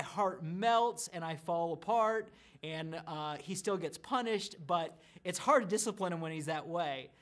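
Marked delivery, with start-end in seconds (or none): none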